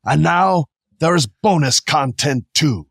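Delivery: put-on Viking accent, hammed up on the spot. Host reads listener review aloud in an improvised Viking accent. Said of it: "This is my Viking voice".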